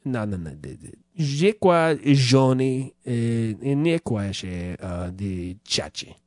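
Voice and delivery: mock French-ish gibberish; fake foreign language. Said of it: "pretending to be fucking foreign".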